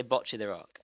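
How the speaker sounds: "Bocce" as "BOTCH-ee", short rounded o; low, soft demo of British "Bocce"; British pronunciation. Shown as a demonstration. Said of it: "And I say".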